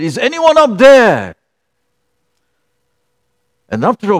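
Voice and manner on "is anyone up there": higher, louder desperate call upward; shouting up from a cliff edge; retold shout. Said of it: "he shouted".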